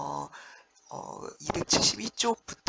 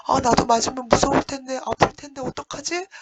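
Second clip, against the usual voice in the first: much higher, louder, fast fretting; scared fretting; 8 semitones higher, 6 dB louder than usual. Retold or imitated. Teacher voicing a frightened Heungbo, high fast fretting. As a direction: fret like a coward about to be beaten. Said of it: "막 이렇게 해야 되잖아".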